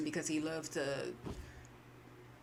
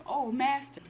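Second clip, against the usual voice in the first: chuckling servant; 7 semitones higher than usual. Retold or imitated. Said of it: "laughing like".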